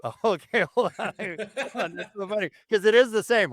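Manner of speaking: high, giggly words through laughter; laughing. Real, not acted. Host caught out over his accents, laughing. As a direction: try to talk while cracking up.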